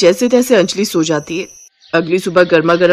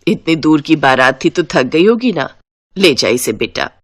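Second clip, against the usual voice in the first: lower, motherly elder voice; story mother-in-law, pitched down; kindly mother-in-law; 5 semitones lower than usual. Acted. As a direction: send the tired bride to rest in a lower voice. Mother-in-law welcoming the bride.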